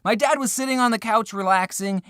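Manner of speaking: ordinary delivery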